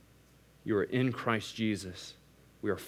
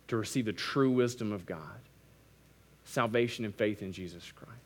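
whispered; 3 semitones lower, 5 dB quieter than usual. Real, not acted. Sermon drops to a hush.

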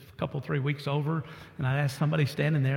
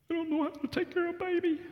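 much higher, softer voice of the crying wife; tearful wife; 13 semitones higher, 4 dB quieter, faster than usual. Retold or imitated. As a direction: speak like the wife near tears. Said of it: "she's sobbing".

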